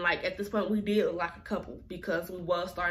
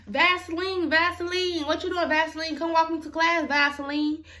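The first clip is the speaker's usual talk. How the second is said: higher, louder sing-song calling of a nickname; mimicking the girls; 7 semitones higher, 4 dB louder than usual. Narrator mimicking girls calling Vaseline, higher, louder sing-song. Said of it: "They're like".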